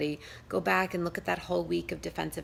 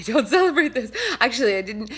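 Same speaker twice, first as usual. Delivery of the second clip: laughing; 5 semitones higher, 9 dB louder than usual. Real, not acted. Laughs at own admission.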